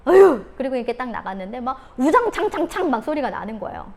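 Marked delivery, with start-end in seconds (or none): 0.0-0.4 loud, high fed-up groan; mom's angry huff at a messy room; exasperated huff. Retold or imitated. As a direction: blow up in frustration. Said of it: "엄마가 화가 나서".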